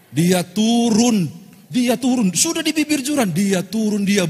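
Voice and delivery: high, loud, slow dia turun; breathless loud relief. A narrator's reading. Preacher recounts to the congregation the pastor climbing down, breathless. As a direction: tell it with trembling relief. Relief after stopping at cliff.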